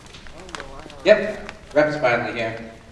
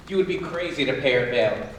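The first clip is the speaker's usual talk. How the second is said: usual speaking voice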